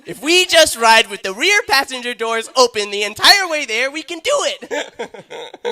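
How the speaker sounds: high, loud frantic pleading; desperate voice. Acted. Man begging to make the bike fit, high desperate yell. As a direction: shout it as a hammed-up last-ditch plan. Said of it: "All right, enough with the voice".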